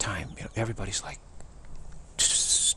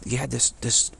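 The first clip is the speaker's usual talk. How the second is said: ordinary talk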